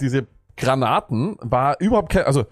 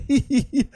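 mock giggle; 8 semitones higher than usual. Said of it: "Wir sagen doch alle".

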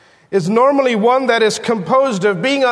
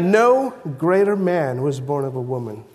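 reverent quote; 7 semitones lower than usual. Acted. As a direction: hush your voice as if quoting holy words. Quoting Jesus's words.

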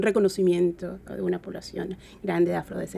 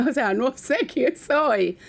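amused, slower, through a laugh; 5 semitones higher, slower than usual. Real, not acted. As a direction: laugh while saying it. Laughing at her own question.

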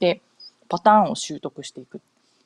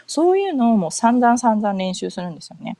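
normal talking voice

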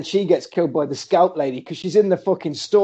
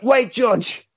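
higher-pitched acted plea; hammed-up doomed hero; mock urgent call; 7 semitones higher than usual. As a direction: call a friend back dramatically. Acts Ken calling George back.

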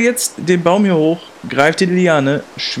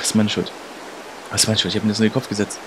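low, soft self-blame; guilty murmur; 10 semitones lower, 8 dB quieter, faster than usual. Acted. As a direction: drop pitch and volume, blaming yourself. Blames himself for the fall.

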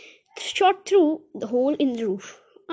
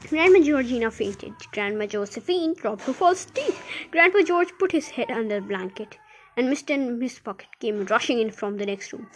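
plain reading voice

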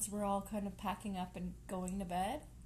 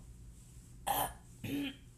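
fake, hinting cough; pointed throat clearing; 7 semitones higher than usual. Host hinting at herself and co-host, pointed ahem. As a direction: clear the throat as a not-so-subtle hint. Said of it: "that was me subtly".

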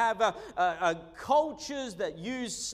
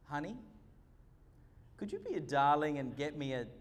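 softly coaxing, quieter, a little quick; a tender, softened plea for a favour; soft sweet request; 8 semitones lower, 6 dB quieter, faster than usual. Retold or imitated. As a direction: ask sweetly like a wife calling from bed. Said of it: "I'll hear these soft words".

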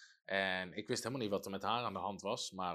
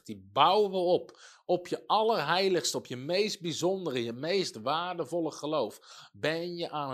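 raised, ringing sermon voice, pressing each word home; preaching at full force; 5 dB louder than usual. Acted. Pastor urging the church to build up their faith, preaching loudly.

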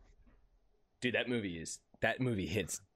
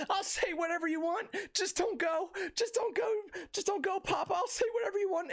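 put-on high childlike voice begging him to stay; a daughter sobbing don't go, Papa; little girl pleading. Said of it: "And she's like".

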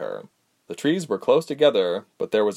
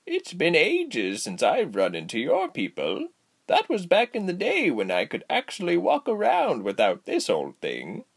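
put-on reminiscing old woman; 8 semitones higher than usual. A narrator's reading. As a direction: reminisce in a lilting granny voice.

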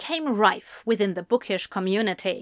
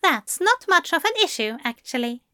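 mildly smug, higher-pitched author; 7 semitones higher than usual. Acted. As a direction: let a little self-satisfaction lift the voice. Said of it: "Aziraphale said smugly".

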